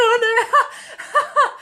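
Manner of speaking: high, laughing mock panic; laughing dread. Bracing for a stranger's hug, laughing high. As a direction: squeal it through a laugh. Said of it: "and I was like".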